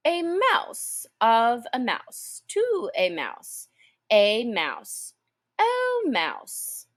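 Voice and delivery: slow declension chant of "mouse"; reciting grammar. A narrator's reading. Alice recites grammar cases to the mouse. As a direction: recite "A mouse of a mouse, to a mouse" like a Latin lesson. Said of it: "her brother's Latin and grammar".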